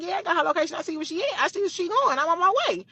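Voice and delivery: on the way to fight her, charged up; heated. Retold or imitated. Telling cousin she's coming.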